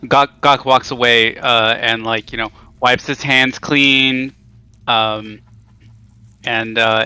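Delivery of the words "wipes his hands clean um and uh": ordinary delivery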